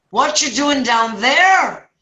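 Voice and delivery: lightly voiced priest, high and drawn out; slow, high call down as the priest; Father Murphy. Priest questions the man in the hole, lightly acted priest voice. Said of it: "it's Father Murphy".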